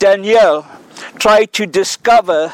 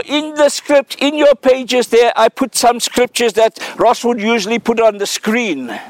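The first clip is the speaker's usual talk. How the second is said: ordinary talk, nothing marked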